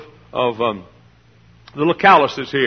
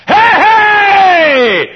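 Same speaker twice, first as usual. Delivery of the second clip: excited announcer's high, full-voice shout; Brickhouse; 10 dB louder than usual. Acted. Announcer cheering a home run, excited shout. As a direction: holler it out long and high. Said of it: "somebody that gets excited".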